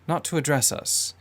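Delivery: usual speaking voice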